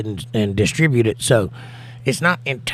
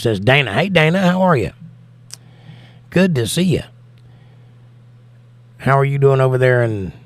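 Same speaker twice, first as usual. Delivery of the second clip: normal talking voice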